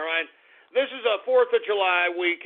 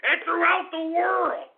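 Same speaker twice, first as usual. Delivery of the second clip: angry shout; 11 semitones higher, slower than usual. Real, not acted. Host to worldwide listeners, a high angry shout. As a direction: throw it out at the whole world.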